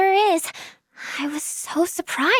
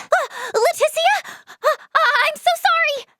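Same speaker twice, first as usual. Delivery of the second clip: very high, startled stammer; flustered apology; 12 semitones higher than usual. Acted. Mistook a friend for the doctor, flustered.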